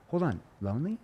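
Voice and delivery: genuinely confused question. Shown as a demonstration. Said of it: "I have to use that confused tone".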